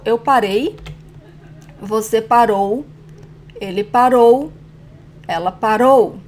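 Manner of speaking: conjugation drill with "parei" and "parou" strong on the ending; paREI, paROU, each final syllable emphatic; stress last syllable. Shown as a demonstration. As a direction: hit -rei in "parei" and -rou in "parou". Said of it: "it's the last syllable".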